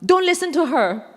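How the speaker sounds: high, dismissive line; imitating the man. Man telling his group to ignore her, high dismissive voice. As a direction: wave her off to his friends. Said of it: "And then he said".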